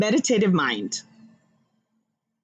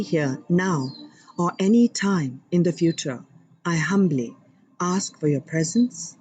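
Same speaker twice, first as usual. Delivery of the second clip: humble prayer. Acted. Host invoking God and guides for viewers, reverent prayer voice. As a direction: pray humbly and sincerely. Opening prayer to divine beings.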